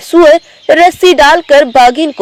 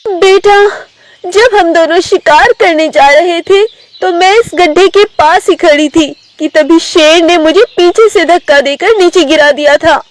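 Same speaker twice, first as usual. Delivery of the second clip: higher-pitched tigress voice; mother tigress explaining; 6 semitones higher than usual. Acted. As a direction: recount the betrayal to the cubs. (ऊँची आवाज़ में बाघिन)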